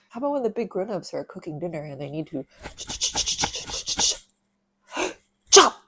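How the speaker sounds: repeated ch- building to a loud "chop"; chopping sound game for kids. Shown as a demonstration. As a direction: chop the ch over and over then hit "chop". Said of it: "Can you do that with me".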